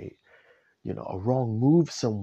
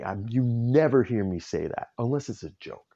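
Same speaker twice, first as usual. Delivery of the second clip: ordinary talk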